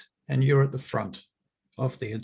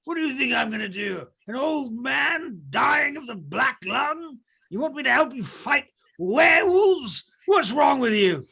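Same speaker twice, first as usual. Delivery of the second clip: put-on angry old man; 11 semitones higher than usual. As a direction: rant like a sick old man.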